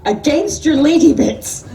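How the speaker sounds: very high, slow, loud appalled screech. A narrator's reading. Dan horrified at Freya's wig, slow high screech. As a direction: shriek the last words in horror. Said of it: "he screeched".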